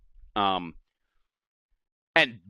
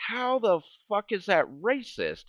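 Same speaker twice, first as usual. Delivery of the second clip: indignant disbelief; 11 semitones higher than usual. Retold or imitated. Retold indignant reply to friend.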